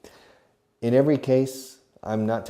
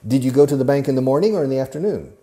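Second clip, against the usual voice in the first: 1 loud quizzing question; 5 semitones higher, 8 dB louder, much faster than usual. Shown as a demonstration. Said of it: "teacher plays questioner".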